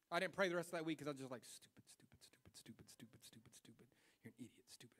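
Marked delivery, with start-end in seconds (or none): 1.4-5.0 whispered, rapid self-scolding; beating himself up. Retold or imitated. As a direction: mutter insults at yourself under your breath. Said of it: "I'm just beating myself up".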